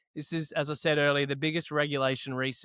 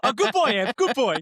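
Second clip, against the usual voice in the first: higher, louder doggy praise voice; 8 semitones higher, 6 dB louder than usual. Acted. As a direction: praise him like a pet dog, pitched up. Praising cohost like a dog.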